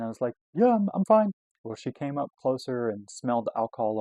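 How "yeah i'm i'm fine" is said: high, loud mock-innocent answer; overeager reassurance. Drunk student to campus cop, overeager reassurance. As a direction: insist in a hammed-up high voice.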